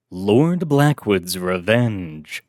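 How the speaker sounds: slow dramatic title read; title announcement. A narrator's reading. Narrator announcing the story title, slow and dramatic. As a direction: let "Lord Blackwood's Revenge" hang slowly. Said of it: "announcing the story title".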